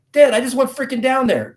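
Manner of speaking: retold whiny-loud protest, pitched high; exasperated protest. Son objects to dad sending him back, exasperated.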